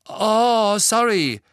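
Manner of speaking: high, loud apology; called-out apology. A narrator's reading. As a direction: call back a loud sorry. Ron calls back after scolding.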